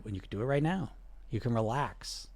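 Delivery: calming guided relaxation. Acted. Coach easing viewer into releasing regret, soft calming voice. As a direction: ease them into letting go of the past.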